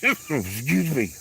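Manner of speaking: slow, high, strangled apology; real choked voice; choking up. Real, not acted. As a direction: squeeze it out mid-coughing fit. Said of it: "start choking up here".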